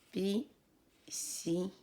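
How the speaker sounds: Bicyclette slowly split into three beats; syllable by syllable. Shown as a demonstration. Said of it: "Nous corrigeons".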